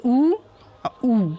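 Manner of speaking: ऊ said alone and drawn out, lips rounded; the ऊ sound, demonstrated; vowel sound demo. Shown as a demonstration. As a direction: show how ऊ is voiced, said on its own twice. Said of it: "जैसे उसको बोलने का तरीका है".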